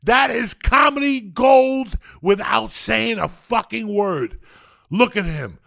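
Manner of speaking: high, loud, slow ecstatic shouting; ecstatic rave. Real, not acted. Praising Pee-wee's bar dance to the room, ecstatic shouting. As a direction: rave like it's the greatest scene ever. Raving about favourite comedy scene.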